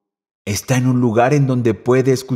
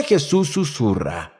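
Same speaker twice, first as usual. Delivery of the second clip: softer, sinking to a hush; 5 dB quieter than usual. Shown as a demonstration. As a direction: let it fall quiet like a whisper. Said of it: "Primera diferencia". (voz suave y apagada)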